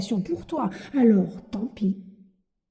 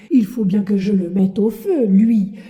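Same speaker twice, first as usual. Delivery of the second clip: louder, firm and unbending; stern insistence; 7 dB louder than usual. Acted. Puppeteer refuses Pinocchio, louder and unbending. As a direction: lay down the law, voice rising.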